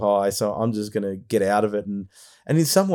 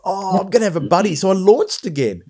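excited; 10 semitones higher, 6 dB louder than usual. Retold or imitated. Hopeful at a new flier arriving.

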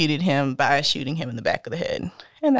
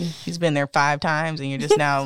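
normal talking voice

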